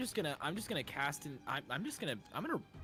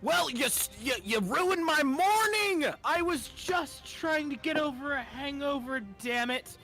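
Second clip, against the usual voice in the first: angry yell; 12 semitones higher, slower than usual. Acted. Character keeps berating giant.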